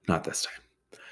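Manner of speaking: breathy whispered aside. Acted. Comic whispering aside to the audience. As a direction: whisper it like a secret.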